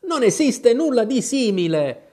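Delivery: exasperated storybook Alice; getting angry. A narrator's reading. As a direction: object crossly, pitch jumping up. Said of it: "Alice cominciava ad arrabbiarsi sul serio".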